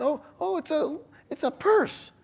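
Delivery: stammering high falsetto, imitating the wife; flustered wife. Wife explains new purse to husband, stammering falsetto.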